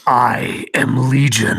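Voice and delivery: slow, loud menacing intro; ominous Legion voice. Acted. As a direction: announce yourself like a demon.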